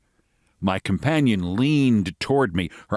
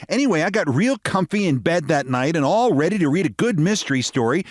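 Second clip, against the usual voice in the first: American lady voice; 8 semitones higher than usual. Acted.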